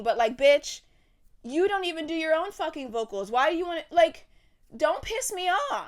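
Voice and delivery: high, heated telling-off; worked-up outburst, climbing in pitch; exasperated rant. Real, not acted. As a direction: snap at her in rising indignation. Ranting at the singer directly.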